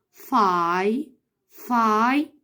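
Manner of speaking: فاي drawn out slowly; one beat at a time; slow sounding out. Shown as a demonstration. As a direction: stretch فاي like a teacher. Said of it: "طب فايتر".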